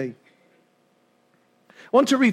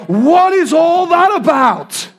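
mock outrage; 6 semitones higher, 4 dB louder, slower than usual.

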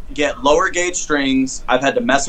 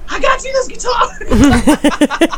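high, slow, drawn-out tipsy mom imitation; drunk mom voice; much slower than usual. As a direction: play the tipsy mom handing over a gift. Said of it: "She's like".

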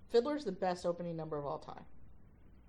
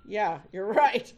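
sarcastic; 10 dB louder, slower than usual. Retold or imitated. Sarcastic reply to late student.

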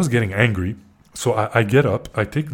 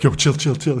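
higher, louder imitation of a friend intervening; urgent calming; 4 semitones higher, 4 dB louder than usual. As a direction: imitate a pal urgently talking someone down. Retold friend calming him down.

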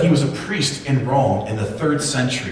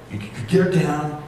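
ordinary talk

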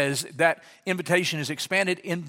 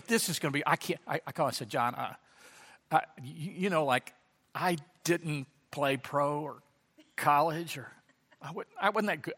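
softer, hesitant, halting; nervous mumble; 2 semitones lower, 7 dB quieter than usual. Retold or imitated. Pastor retelling nervously calling John, soft and halting. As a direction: mumble it like you're backing out.

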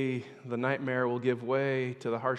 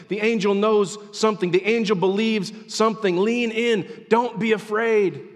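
high, loud pulpit exhortation; rousing, insistent sermon voice; impassioned preaching; 7 semitones higher, 8 dB louder than usual. Acted. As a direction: drive each short line home fervently. Preaches at full force.

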